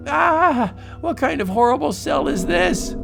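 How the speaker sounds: high horrified shriek; horrified cry. Acted. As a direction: recoil in horror. Horrified in cell.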